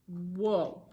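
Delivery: ordinary delivery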